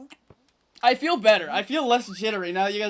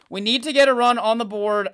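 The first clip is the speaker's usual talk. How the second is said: louder, punching each word as a rally cry; emphatic repeat; 2 semitones higher, 7 dB louder than usual. Real, not acted. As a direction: call it out like a team motto. Repeats the message with conviction.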